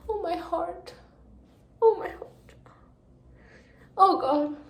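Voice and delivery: high, breathy swoon. Real, not acted. Reader swooning over a love letter. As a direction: clutch your heart.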